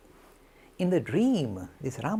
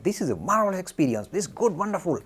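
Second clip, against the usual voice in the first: high, loud, thrilled exclamation; acting out excited delight; 6 semitones higher, 7 dB louder than usual. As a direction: gush loudly over a marvelous experience. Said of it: "was very excited".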